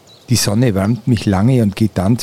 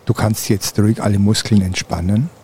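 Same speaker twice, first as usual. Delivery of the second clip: guided meditation. Acted. Leads a guided relaxation.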